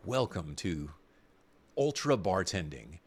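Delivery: commercial voice. Pretend. Host pitches imaginary robot bar in a commercial voice. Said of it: "the commercial voice".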